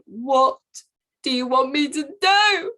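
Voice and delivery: tearful shout; desperate cry out. Retold or imitated. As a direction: cry out to the universe in despair. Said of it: "Like, crying".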